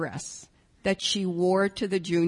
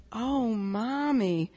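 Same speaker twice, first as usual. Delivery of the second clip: slow, tender imitation of a grown daughter; higher, kindly, unhurried voice; very loving, kind; 4 semitones higher, 3 dB quieter, slower than usual. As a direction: break it to her gently, drawing out the words. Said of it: "this very loving, kind voice".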